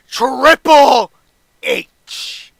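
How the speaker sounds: high, very loud drawn-out shout; shock reveal. Acted. Paul Heyman revealing SmackDown's final pick, shouted reveal. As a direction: roar the name, then stretch out the last letter.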